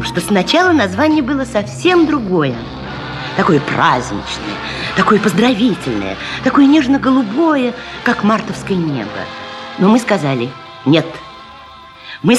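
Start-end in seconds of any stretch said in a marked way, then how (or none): none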